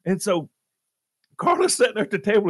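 usual speaking voice

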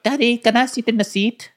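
very high mock kid voice with an accent; squeaky put-on Russian child. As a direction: pipe up like a little Russian boy. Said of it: "they brought their kids into the cockpit".

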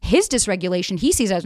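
ordinary delivery

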